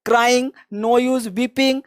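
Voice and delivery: very slow, drawn-out model phrase; slow drill. Shown as a demonstration. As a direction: stretch it out for students. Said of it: "teacher models phrase slowly". (बहुत धीरे अभ्यास)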